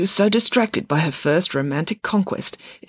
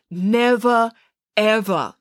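"Never, ever" with a pause between, each word stressed; emphatic rejection; 7 semitones higher, 3 dB louder, much slower than usual. Acted. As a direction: land "Never" and "ever" separately, pitched up. Friday repeats rejection for clarity.

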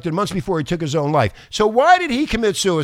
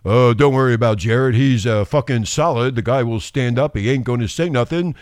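lower, lightly voiced suit reassuring the others; mock co-conspirator voice; 8 semitones lower than usual. Mocking the suits who say Jared won't talk, low mock insider voice. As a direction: play a smug insider brushing off worry. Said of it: "they're all saying".

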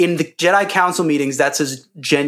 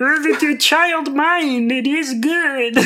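high, very slow little alien voice; Yoda voice; 12 semitones higher, much slower than usual. Retold or imitated. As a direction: croak it slowly like wise Yoda. Quoting Yoda in the archives scene.

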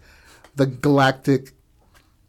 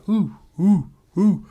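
calling out a name; 6 semitones higher, slower than usual. Retold or imitated. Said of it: "shout Zed's name".

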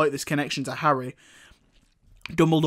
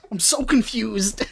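higher, slow, through laughter; real baffled giggling; laughing bafflement; 7 semitones higher, 4 dB quieter, slower than usual. Real, not acted. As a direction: say it helplessly while cracking up.

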